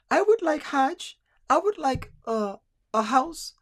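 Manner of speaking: high, slow put-on Filipino woman. Young bride listing her dowry wishes to a suitor, mock Filipino woman voice. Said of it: "this is my Filipino woman voice".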